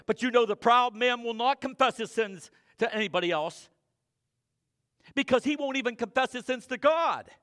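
loud, high-pitched preaching; fiery pulpit preaching; full-force preaching. Acted. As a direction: drive the sermon home to the pews. Preaching to the congregation.

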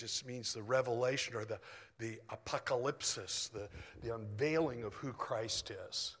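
usual speaking voice

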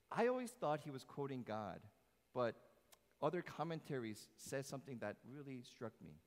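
usual speaking voice

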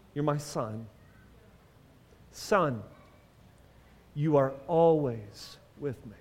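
quiet, low loving father; tender fatherly reassurance. Acted. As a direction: drop low and soft, gently reassuring. Father reassuring his older son.

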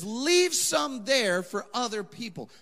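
slow, high, loud plea; slow, loud preached command; slow emphatic preaching. Acted. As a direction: drive it home slowly.

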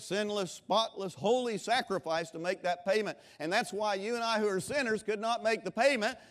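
loud, high preaching to the congregation; full-force preaching. Acted. Preacher booming to the congregation about Lazarus, full-force preaching. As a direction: preach it out with fire.

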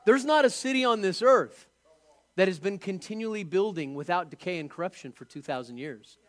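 usual speaking voice

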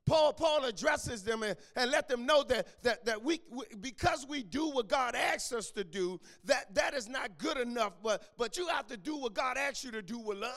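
loud, rhythmic preaching that builds; preaching at full force. Acted. Preacher driving the point home to the congregation, loud and rhythmic. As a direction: drive the point home to the church.

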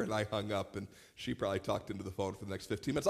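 normal talking voice

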